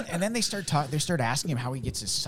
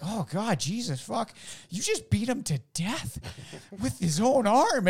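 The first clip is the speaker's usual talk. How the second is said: shocked alien; 10 semitones higher, 4 dB louder, slower than usual. Retold or imitated. Aliens shocked in retold story.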